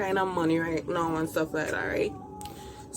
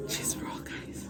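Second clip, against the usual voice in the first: whispering; 13 dB quieter, slower than usual. Real, not acted. Hushed reveal of the food.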